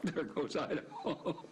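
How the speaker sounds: laughing. Real, not acted. Laughing while retelling the flight.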